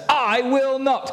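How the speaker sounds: high, slow, drawn-out I will not; a child digging in his heels; defiant refusal. Acted. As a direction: stretch it out like a stubborn kid. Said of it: "Can you say it really loudly?".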